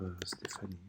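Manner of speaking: breathy whisper, words barely voiced; whispered while typing. Real, not acted. Teacher typing a name into the form, whispered. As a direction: mouth the name as you type it.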